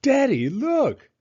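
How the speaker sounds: put-on high, loud, drawn-out child's call; excited little girl. A narrator's reading. Little girl calling her father to look at her cart, excited and high. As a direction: show dad the treasure, thrilled. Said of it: "and said".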